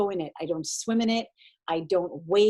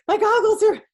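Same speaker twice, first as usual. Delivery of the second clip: high, louder panicked blurt; panicky; 12 semitones higher, 7 dB louder than usual. Retold or imitated. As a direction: gasp it out like you're scared in the water. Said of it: "I'm like".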